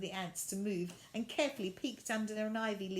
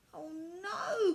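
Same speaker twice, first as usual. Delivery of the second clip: crying out; 11 semitones higher, 6 dB louder than usual. A narrator's reading. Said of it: "cried Violet".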